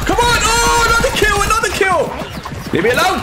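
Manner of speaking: high-pitched celebratory yelling; exhilarated. Real, not acted. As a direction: yell in triumph at each kill. Celebrates kills.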